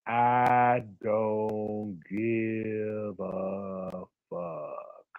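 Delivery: very slow, deep, drawn-out words; dragged-out slow-motion voice. Shown as a demonstration. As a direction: stretch every word in slow motion. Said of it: "I had to say it in slow motion".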